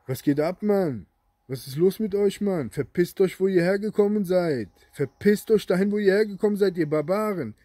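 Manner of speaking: loud, high-pitched furious rant; angry outburst. Real, not acted. Furious rant telling them to leave. (wütend schimpfend, laut)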